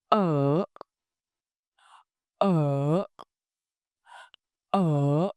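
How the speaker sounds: uh starting mid, dipping, then rising like a question; high tone demo. Shown as a demonstration. Teacher models the dip-rise tone for learners to copy. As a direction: draw the question mark shape with uh. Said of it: "Repeat after me".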